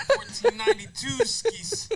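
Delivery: high, slow laughing tease. Real, not acted. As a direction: squeal it through laughter. Laughing mockery of shoes.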